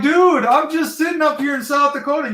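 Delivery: high-pitched, worked-up comeback; retold indignant retort. Caller in South Dakota insulting the dispatcher, retold indignant retort. Acted retort in phone quarrel.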